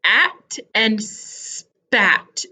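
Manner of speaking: HAT and SPAT split as h...at, s...pat; very slow At and spat, first sounds held apart; separated first sounds. Shown as a demonstration. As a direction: isolate the h and the s, then finish each word. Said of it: "sounds at the beginning of the word".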